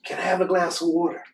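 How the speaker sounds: a pleading little critter asking fast; raccoon voice. Acted. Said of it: "The raccoon said".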